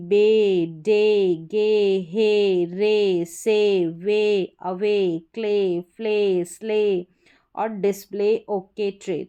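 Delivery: each word ending बे, डे, गे read slowly with a clear ay; teacher spelling out the ay sound word by word. Shown as a demonstration. Teacher reading a word list to students, drawing out ay. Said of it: "इस एवाई का उच्चारण ए करके होगा".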